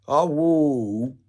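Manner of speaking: ordinary talk, nothing marked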